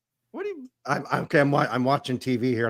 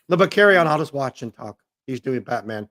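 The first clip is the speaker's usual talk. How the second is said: usual speaking voice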